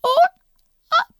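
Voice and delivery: put-on very high fast squeaky baby bear; very high rushing baby voice. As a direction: squeak it like a tiny cub. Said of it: "said Baby Bear".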